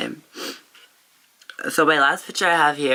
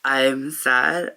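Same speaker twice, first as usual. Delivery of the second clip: normal talking voice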